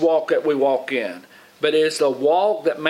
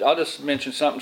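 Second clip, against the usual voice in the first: ordinary delivery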